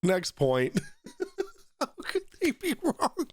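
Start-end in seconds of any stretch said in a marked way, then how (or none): 0.8-1.5 high squeaky giggle. Real, not acted. Laughs at the video. 1.8-3.3 high, laughing mock repeat; laughing mockery. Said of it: "Wait, this can't be real".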